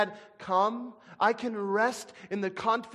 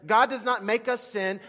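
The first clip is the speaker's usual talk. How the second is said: ordinary talk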